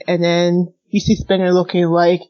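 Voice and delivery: usual speaking voice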